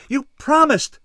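high, loud accusing wail; distressed cry. A narrator's reading. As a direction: cry out as if betrayed. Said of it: "Shara cried".

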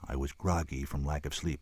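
usual speaking voice

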